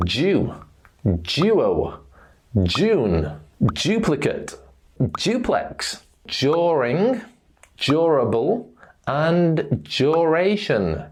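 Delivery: "duo", "duplex", "during" starting with a J sound. Shown as a demonstration. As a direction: say the D of "duplicate", "durable", "duration" like the J in June. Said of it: "D sounds like a J in these words".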